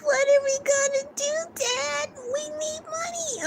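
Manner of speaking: very high, fading cartoon child voice; cartoon kid pleading with Dad, very high and fading; whiny cartoon kid. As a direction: squeak it like a worried cartoon kid. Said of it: "They be like".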